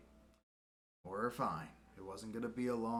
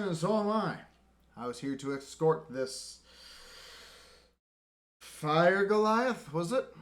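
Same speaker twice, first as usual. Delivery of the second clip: loud, higher scolding officer; stern lieutenant; 6 semitones higher, 7 dB louder than usual. Acted. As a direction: put the subordinate in his place.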